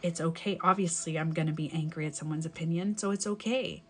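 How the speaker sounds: soft, low reassurance; self-soothing. Acted. Person telling themselves anger is okay, soothingly. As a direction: gently give yourself permission.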